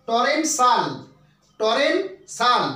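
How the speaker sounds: টরেনশাল stressed on RE, ending shal; teacher modeling টরেনশাল; pronunciation model. Shown as a demonstration. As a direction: say টরেনশাল slowly and clearly, toh-REN-shal. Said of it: "এর উচ্চারণটা হচ্ছে".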